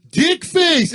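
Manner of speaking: high, loud yell over the music; shouting. Retold or imitated. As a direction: shout to a friend over a band. Yelling to friend at concert.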